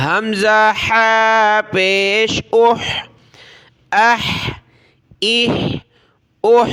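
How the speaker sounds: ہا squeezed from the throat; strong constricted breath closing each اہ; forceful throaty haa. Shown as a demonstration. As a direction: push ہا hard from the throat. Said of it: "ہا کو گلے سے نکالا زور لگا کر".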